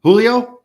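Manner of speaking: Julio as a high, loud HOO-lee-oh?; questioning H pronunciation. Shown as a demonstration. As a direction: check Julio with an H, rising as a question. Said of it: "Is that how you say it".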